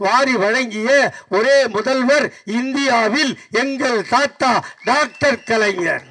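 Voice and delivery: rolling stage oratory with rhythmic question-and-answer cadence; rhetorical litany. Acted. Speaker reciting grandfather's free schemes to a rally, rhetorical litany. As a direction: chant out each question, then answer it like a benefactor.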